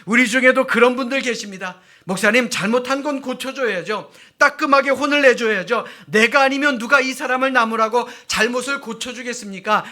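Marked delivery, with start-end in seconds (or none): 2.0-9.8 loud, higher scolding voice of a strict believer; loud, insistent rebuke; mock stern congregant. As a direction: play the zealous fixer, scolding loudly. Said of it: "라고 말씀하시는 분들".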